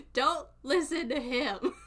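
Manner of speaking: loud, drawn-out plea through laughter; laughing protest. Real, not acted. Streamer warning viewers off a bad influence, loud laughing protest. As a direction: cry it out slowly, cracking up.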